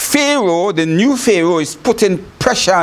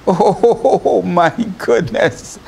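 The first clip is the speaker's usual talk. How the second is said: ordinary talk, nothing marked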